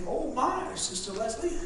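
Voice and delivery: hushed high oh my; quiet. Retold or imitated. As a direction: say it softly, a bit stung. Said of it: "they say".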